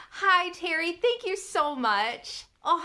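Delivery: usual speaking voice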